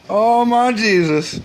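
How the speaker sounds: ordinary talk, nothing marked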